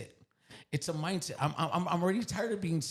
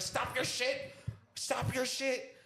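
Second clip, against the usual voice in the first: retold panic, pitched way up; panicking; 9 semitones higher, slower than usual. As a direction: beg them in a high, stretched-out voice. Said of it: "like, panicking".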